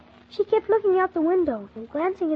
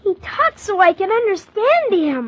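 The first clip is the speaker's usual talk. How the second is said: put-on boy's loud protest; 5 semitones higher, 7 dB louder than usual. Boy protests loudly.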